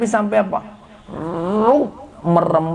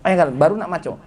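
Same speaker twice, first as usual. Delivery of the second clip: ordinary delivery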